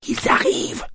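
excited whisper; à voix basse. A narrator's reading. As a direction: whisper the alert urgently. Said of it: "répéta Yosp à voix basse". (chuchotement pressé)

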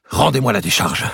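snatch at it with your voice; demanding. A narrator's reading.

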